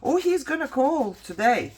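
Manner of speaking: high, sing-song fake-polite voice; mocking receptionist. Patient mocking the GI's receptionist, high and fake-sweet. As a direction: mimic a breezy receptionist brushing her off.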